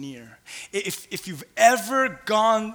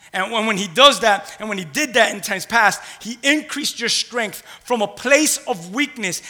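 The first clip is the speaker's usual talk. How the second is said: preaching at full force; 4 semitones higher, 7 dB louder than usual. Acted. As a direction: lift it up and declare it.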